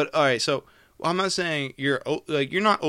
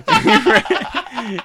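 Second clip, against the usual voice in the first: laughing; 10 dB louder than usual. Real, not acted.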